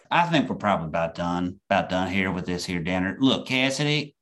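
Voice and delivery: a mild Southern preachy relative voice; Southern accent. Acted. Uncle lecturing niece at dinner in light Southern accent. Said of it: "my Southern accent's getting more".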